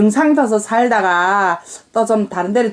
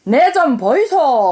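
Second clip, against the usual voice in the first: slow, drawn-out Gyeongsang accent; Gyeongsang dialect; slower than usual. Shown as a demonstration. Mother demonstrates "excuse me" for her daughter, slow Gyeongsang dialect. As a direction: call for attention in Gyeongsang dialect. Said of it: "경상도는 뭐라고 해요?".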